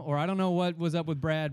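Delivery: ordinary delivery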